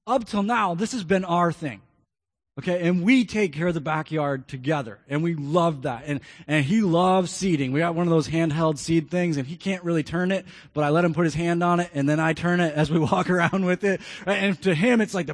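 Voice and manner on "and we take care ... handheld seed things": ordinary talk, nothing marked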